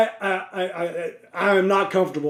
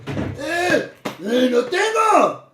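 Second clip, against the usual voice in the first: slow, strained imitation with a Mexican accent; imitated Mexican accent; 7 semitones higher, much slower than usual. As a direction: mimic the stranger's halting Mexican accent. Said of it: "and he goes like this".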